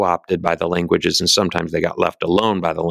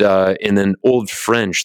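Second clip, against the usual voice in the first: ordinary delivery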